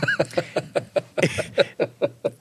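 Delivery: breathless high laugh; wheezy laugh. Real, not acted. Laughing at the memory. (atemloses, hohes Lachen)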